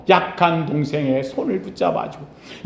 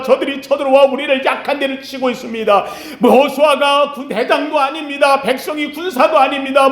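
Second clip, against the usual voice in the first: crying out in prayer; 5 semitones higher than usual. Acted. Moses pleads with God as Amalek attacks, crying out in prayer. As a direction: cry out to God as the desperate Moses, dropping on the last plea. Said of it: "모세가 부르짖을 때".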